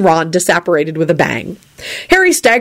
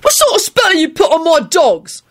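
high-pitched constable's demand; accusing, squawky constable voice; indignant constable; 11 semitones higher than usual. A narrator's reading. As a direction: play an outraged officer, voice pitched way up. Same constable accusing Lupin.

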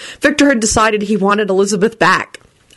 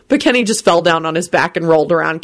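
plain reading voice

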